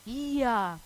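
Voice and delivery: plain reading voice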